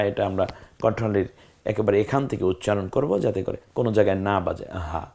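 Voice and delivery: normal talking voice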